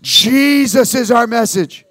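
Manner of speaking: "Jesus is our message" called out slow and loud; loud slow declaration. Shown as a demonstration. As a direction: lead the church in saying "Jesus is our message". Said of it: "Say it out loud".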